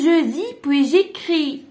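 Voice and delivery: slow, syllable-by-syllable model reading of "je dis, puis j'écris"; slow dictation. Shown as a demonstration. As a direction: spell out "je dis, puis j'écris" slowly for the pupils. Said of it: "teacher models French phrase".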